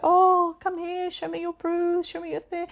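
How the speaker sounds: high, cooing concern; husband fussing over the kids' bruise, high cooing; tender dad. Retold or imitated. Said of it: "He's like".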